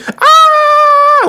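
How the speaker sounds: very high, loud mock scream; hammed-up terrified yell; swinging scream. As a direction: shriek while swung between cranes. Ben screaming while swung.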